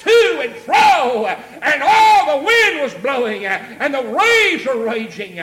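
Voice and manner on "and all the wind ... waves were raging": loud, slow, raised preaching cry; full-force preaching. Real, not acted. Preacher describing the storm to his congregation, full-force preaching. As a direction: preach it loud, stretching the words.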